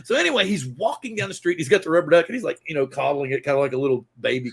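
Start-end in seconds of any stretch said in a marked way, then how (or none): none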